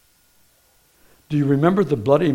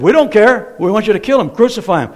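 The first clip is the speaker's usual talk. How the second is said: raised, insistent mob voice; acted crowd demand; 7 semitones higher, 6 dB louder than usual. Crowd demanding Pilate kill Jesus, insistent retold demand. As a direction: push back as an impatient crowd.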